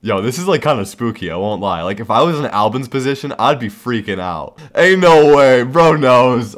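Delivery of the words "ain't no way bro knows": loud, high, can't believe it; half-laughing yell that he's caught; excited disbelief. Real, not acted. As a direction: shout in delighted disbelief. Victim figured out the prank.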